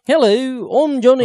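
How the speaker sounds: high, loud mock Liverpool voice. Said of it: "What does [name] sound like?".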